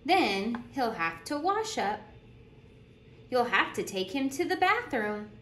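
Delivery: lively storytime voice, bouncing pitch; animated picture-book reading; reading to children. Acted. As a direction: read it like a teacher at story circle. Reads picture book aloud.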